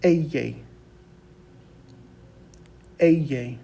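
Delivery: "eye" said as "EH-yeh"; Spanish double L. Shown as a demonstration. Teacher has students repeat after her, "eye" as EH-yeh. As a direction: model "eye" with a y sound, falling. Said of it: "So repeat after me".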